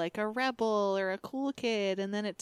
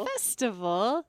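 ordinary talk

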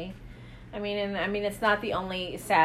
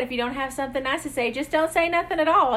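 put-on southern accent; 5 semitones higher, 4 dB louder, faster than usual. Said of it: "Your southern accent".

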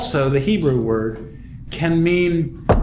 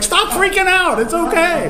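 very high, laughing and a little louder; playful reassurance; 14 semitones higher, 3 dB louder than usual. Retold or imitated. Jesus calming the women at the tomb, high and laughing. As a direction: soothe them with a big grin in the voice. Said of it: "It's like".

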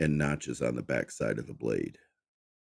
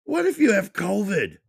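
high, loud whiny panic; panicked squeal; 8 dB louder than usual. Acted. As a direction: squeal about the germs. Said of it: "he squealed".

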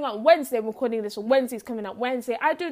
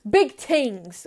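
punchy group chant, louder; hype shout; 7 dB louder than usual. Shown as a demonstration. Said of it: "Say it with me. Three, two, one".

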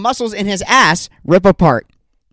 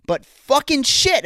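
frustrated curse; 8 semitones higher, slower than usual. Real, not acted. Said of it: "am I pissed".

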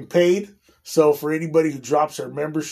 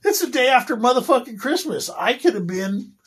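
higher, heated comeback at the boss; retold angry retort; 9 semitones higher, 4 dB louder than usual. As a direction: replay the anger at being laid off. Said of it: "That's why I was fucking pissed".

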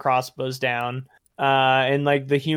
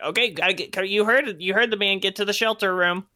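high, hurried goblin voices; panicked goblins; 7 semitones higher than usual. Acted. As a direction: scramble like frightened minions. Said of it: "They're like".

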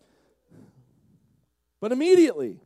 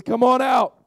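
loud, higher far-off summons; calling out; 6 semitones higher, 8 dB louder than usual. Acted. Jesus inviting Peter out of the boat, calling. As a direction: call across the water. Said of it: "he hears a voice that says".

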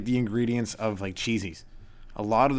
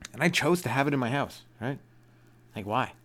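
usual speaking voice

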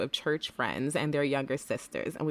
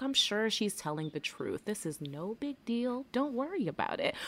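soft reassurance; 4 semitones higher, 6 dB quieter than usual. Retold or imitated. Reassuring friend on phone.

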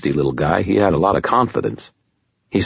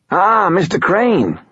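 high, slow, wheezy little lawyer; wheezy nasal voice; 3 dB louder, slower than usual. Acted. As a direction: squeeze it through the nose, wheezing. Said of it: "He had a wheezy, nasal voice".

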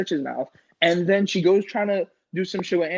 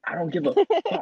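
much higher, put-on foreign-sounding accent voice; Benny's accent; 12 semitones higher than usual. Drunk friend brushing off a warning, in his accent. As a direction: mimic his friend's thick accent, pitched way up. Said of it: "he says in his accent".

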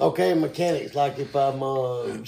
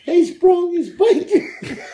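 laughing; 13 semitones higher, much slower than usual. Real, not acted. Teasing, laughing line.

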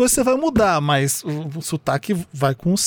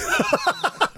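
high laugh; 5 dB quieter than usual. Real, not acted. Laughing at imagined scene.

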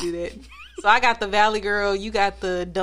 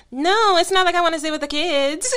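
goofy voice; 6 semitones higher than usual. Shown as a demonstration. Wife retelling a talk with her husband, goofy voice. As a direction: put on a silly raised voice. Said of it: "I do a real goofy voice".

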